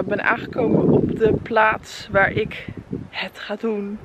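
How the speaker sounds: ordinary talk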